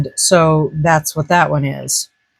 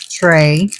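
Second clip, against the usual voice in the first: ordinary delivery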